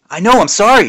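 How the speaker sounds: high, loud sheepish sorry; flustered apology. A narrator's reading. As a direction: blurt the apology. Embarrassed actor apologizing.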